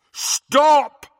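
huge, high shouted command; powerful commanding yell; thundering. Acted. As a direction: thunder it across the hall. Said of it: "Harry thundered".

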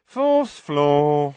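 loud, flat, uninterested call; bored. A narrator's reading. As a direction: toss it out without looking up. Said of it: "said the witch in a bored voice".

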